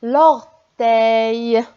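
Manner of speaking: "l'orteil" said loud with the ending "eil" clear; "l'orteil" read out with a clear "eil"; word read out loud. Shown as a demonstration. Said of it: "On a".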